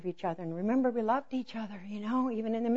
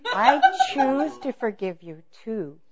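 slow, loud, higher show of forgiveness; mock sincere; 5 semitones higher, 10 dB louder, slower than usual. Wife forgiving her husband while not meaning it, mock sincere. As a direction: overdo meaning it, drawn out. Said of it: "so you sound like you mean it".

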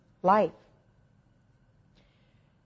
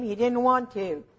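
ordinary talk, nothing marked

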